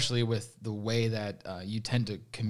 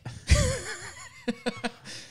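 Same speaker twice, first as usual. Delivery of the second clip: short high-pitched laugh. Real, not acted. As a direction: burst of laughter after a joke. Laughs after quote.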